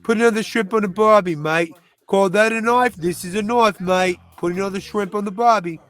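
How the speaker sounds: punchy Aussie catchphrases, broad vowels; Australian accent. Shown as a demonstration. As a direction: show off a hammy Australian accent. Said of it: "used to have a really good Australian accent".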